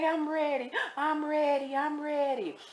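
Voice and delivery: high, drawn-out mock tearful voice; imitated crying. Crying inmate insisting she is ready, imitated high tearful whine. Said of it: "She crying".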